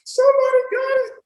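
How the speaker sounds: high, fast excited squeal; mock scream. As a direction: relive the thrill of being understood. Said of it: "I just screamed".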